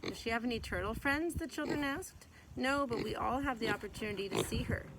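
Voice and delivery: gentle, lively read-aloud voice; storybook reading. Acted. Mother reading a picture book to her child, gentle storytelling.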